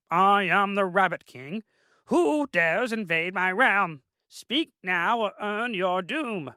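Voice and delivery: high, slow, grand royal rabbit voice; pompous rabbit king. Acted. The Rabbit King challenges the intruders, high and pompous. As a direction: proclaim it like a tiny tyrant on a throne. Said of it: "yelled at Benjamin and the others".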